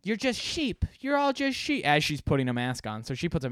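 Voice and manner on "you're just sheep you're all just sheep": put-on high, indignant cry; imitated yelling. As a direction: squeal the insult in her voice. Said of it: "they start, like, yelling at each other".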